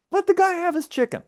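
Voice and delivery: high, pleading outburst; exasperated plea. Real, not acted. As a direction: beg them to let it go. Ranting about injustice.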